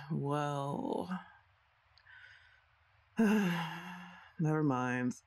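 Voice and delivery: ordinary talk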